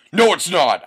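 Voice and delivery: loud, high mock-angry outburst; angry shout. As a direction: shout it back in theatrical anger. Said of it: "I shouted angrily".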